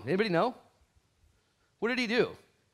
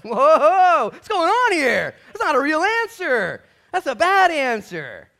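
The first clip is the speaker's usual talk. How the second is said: high, loud, slow shocked outcry; mock outrage; 14 semitones higher, 17 dB louder, much slower than usual. Preacher playing the shocked officiant at a wedding, high and loud. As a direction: gasp at the bad answer, big and slow. Mock reaction to bride's answer.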